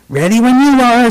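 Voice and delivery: mild storybook shout. A narrator's reading. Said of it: "Susie shouted".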